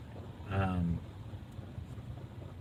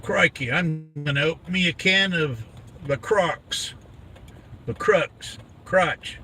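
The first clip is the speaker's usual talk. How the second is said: high mock-Aussie exclaiming; put-on Australian accent, pitched up; 5 semitones higher than usual. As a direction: play a hammed-up Australian. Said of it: "how to sound Australian".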